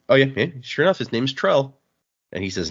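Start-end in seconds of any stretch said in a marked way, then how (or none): none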